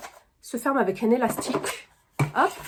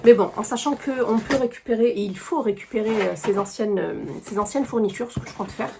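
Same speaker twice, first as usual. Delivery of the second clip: ordinary delivery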